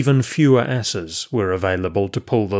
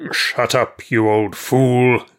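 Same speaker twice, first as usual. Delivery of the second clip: slowing into a drawn-out insult; angry; 3 semitones higher, 3 dB louder, much slower than usual. A narrator's reading. Johanan silencing the prophet, angry. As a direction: cut him off with restrained anger. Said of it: "said Johanan in an angry voice".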